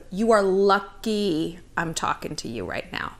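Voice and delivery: slow, soft, lofty put-down; haughty confidence. Retold or imitated. Meeting a hot guy, cool superior murmur. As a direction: let them know they're lucky, low and unhurried. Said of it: "an attitude of".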